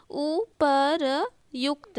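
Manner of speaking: उपरयुक्त very slowly, "u-pa-ra-yukta"; long gaps between the parts of उपरयुक्त; sounded out. Shown as a demonstration. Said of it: "यहाँ पे र आना चाहिए".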